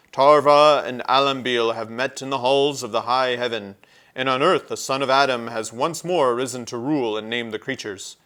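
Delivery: loud, grave proclamation; solemn prophet centaur. A narrator's reading. Centaur proclaiming war to the badger, loud and grave. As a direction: announce fate like a stargazer. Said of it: "He spoke in such a voice".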